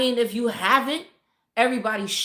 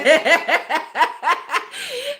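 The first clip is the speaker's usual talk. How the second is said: loud high-pitched giggle; 6 semitones higher, 4 dB louder than usual. Real, not acted. Laughing at a returning viewer, high giggle. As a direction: burst into a squealing laugh. Laughing after teasing Peaches.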